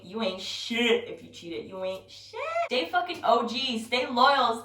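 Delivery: ordinary delivery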